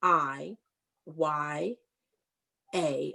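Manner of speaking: letter by letter, a pause after each; spelling it out. Shown as a demonstration. Teacher spells diya for the class, letter by letter. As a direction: spell the word slowly for the class. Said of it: "So let me spell it".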